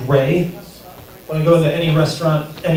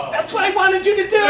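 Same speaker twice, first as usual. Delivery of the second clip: delighted. Real, not acted. Comic delighted at laugh.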